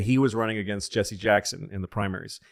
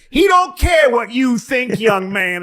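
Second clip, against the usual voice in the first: high, loud, slow put-on scolding shout; dismissive receptionist; 13 semitones higher, 10 dB louder, slower than usual. Receptionist brushes off the complaining caller, loud and high. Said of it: "And he says".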